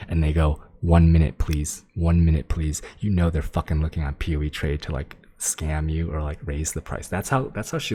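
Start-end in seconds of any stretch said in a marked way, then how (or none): 0.8-2.8 low, loud, punched-out imitation; mimicking a stalling trader, low and emphatic; mock trader reply. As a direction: hit each request loudly in a lowered voice.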